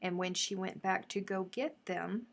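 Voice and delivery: ordinary delivery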